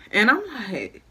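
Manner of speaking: ordinary talk, nothing marked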